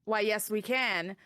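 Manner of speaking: ordinary delivery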